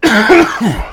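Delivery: hacking smoker's cough; harsh coughing fit. Real, not acted. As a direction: cough hard after a big hit. Coughing after hit.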